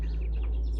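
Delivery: long, voiceless exhale of frustration; exasperated sigh. Real, not acted. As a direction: let out a heavy, fed-up breath. Frustrated with the reskin.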